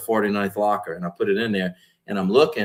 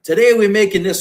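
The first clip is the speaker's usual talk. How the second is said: higher and louder, like a fired-up show host; hyped intro voice; 10 semitones higher, 8 dB louder than usual. Retold or imitated. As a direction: bark the opener at the camera. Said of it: "And he's like".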